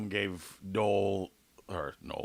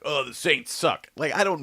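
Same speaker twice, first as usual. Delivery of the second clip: mock angry fan; 6 semitones higher, 5 dB louder than usual. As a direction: imitate a riled-up sports fan jeering. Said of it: "then they get all mad".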